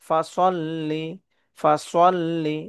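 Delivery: ফাসল্লি with lips rounded on the sa; heavy sad in ফাসল্লি. Shown as a demonstration. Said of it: "ঠোঁট গোল করা হবে".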